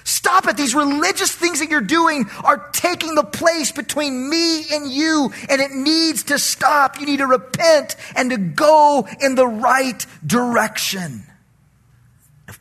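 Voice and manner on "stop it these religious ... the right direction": high, loud, forceful rebuke; God's stern command. Acted. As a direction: thunder it out as God telling them to stop. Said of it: "That's why God says".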